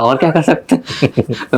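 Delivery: slow, drawn-out mock examiner question; mimicking the interviewer. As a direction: stretch each word like a skeptical interviewer.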